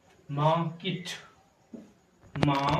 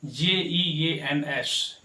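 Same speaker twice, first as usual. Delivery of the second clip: slow, each letter spelled out; much slower than usual. Shown as a demonstration. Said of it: "इसे क्या पढ़ते हैं?".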